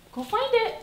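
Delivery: high bright go find it; high dog cue. Real, not acted. Trainer sending her dog after a tossed treat. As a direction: send her off with a lifted voice.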